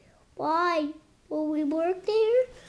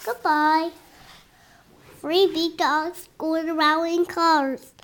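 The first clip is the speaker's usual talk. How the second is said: usual speaking voice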